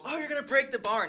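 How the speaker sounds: high, loud mock teacher; scolding teacher. Friend quoting teacher worried about the bar, mock scolding. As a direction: fret loudly as the imitated teacher. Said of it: "your teacher saying".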